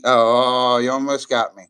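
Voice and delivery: loud surprised laugh-shout; half-shouted, genuinely caught out; caught off guard. Real, not acted. Streamer caught by a viewer's trick, loud surprised outburst. Reacting to a clever troll.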